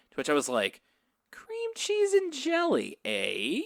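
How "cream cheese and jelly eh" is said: slow, high-pitched pondering; mock intrigued musing. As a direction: draw it out as if weighing up a curious idea. Acting out own intrigued reaction.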